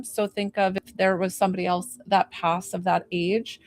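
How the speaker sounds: usual speaking voice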